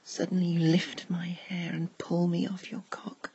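usual speaking voice

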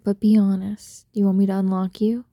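usual speaking voice

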